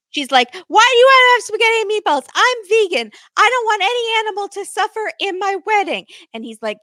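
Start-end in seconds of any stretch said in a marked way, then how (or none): 0.7-6.1 high, loud whining protest; dramatic high-pitched retold outburst; Jasmine. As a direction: imitate an outraged bride, pitched way up. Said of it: "when you did Jasmine".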